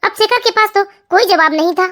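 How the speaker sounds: ordinary delivery, nothing marked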